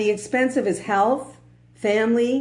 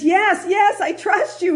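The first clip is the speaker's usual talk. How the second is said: high, eager, rushed agreement; a desperate man agreeing to anything; David, hanging, eagerly answers God; eager yes; 11 semitones higher, 4 dB louder than usual. Retold or imitated. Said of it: "And he says".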